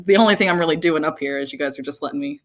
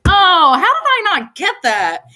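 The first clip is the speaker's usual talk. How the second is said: high, loud, slow "oh" of sudden realization; mock realization; 13 semitones higher, 7 dB louder, slower than usual. Speaker mocks her own late realization, loud and high. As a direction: play the duh moment big.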